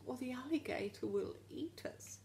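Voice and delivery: whispered hiss; hissing snake. A narrator's reading. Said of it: "hissed the snake".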